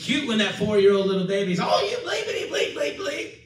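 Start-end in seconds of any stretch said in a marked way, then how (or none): 1.6-3.3 high, fast mock tantrum fading off; cussing toddler. Preacher mimicking a toddler cussing at his mama. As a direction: spit it out like a little kid cursing.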